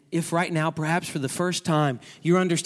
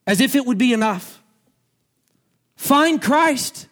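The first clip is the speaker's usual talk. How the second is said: slow, high, loud pulpit appeal; preaching at full force; 9 semitones higher, 7 dB louder, slower than usual. Acted. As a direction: build to a slow fervent climax.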